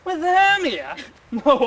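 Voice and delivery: mocking outrage at the name; scornful disbelief. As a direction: scoff at the very idea.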